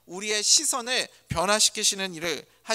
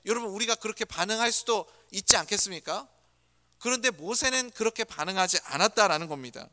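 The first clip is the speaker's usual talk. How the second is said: usual speaking voice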